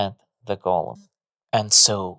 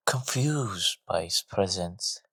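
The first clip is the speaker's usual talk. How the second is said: ordinary delivery, nothing marked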